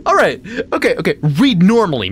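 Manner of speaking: high-pitched yelp at yourself; exasperated outburst. Real, not acted. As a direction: scold yourself, rising to a cry of STOP. Scolds self for slipping into accent.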